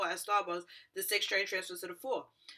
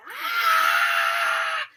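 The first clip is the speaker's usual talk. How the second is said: long, piercing high scream; full-volume shriek of shock, held long; terrified scream; 13 dB louder than usual. Retold or imitated. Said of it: "I just started yelling and crying".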